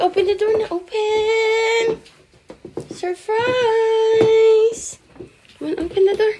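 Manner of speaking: high, loud excited dog talk; hyped up cooing at the dog. Real, not acted.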